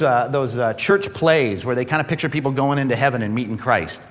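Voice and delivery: normal talking voice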